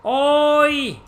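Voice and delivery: oi, high and loud, called out sharply; imitating oi. Streamer mimics how the Japanese say oi. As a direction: throw the oi up high like a call. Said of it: "They all say".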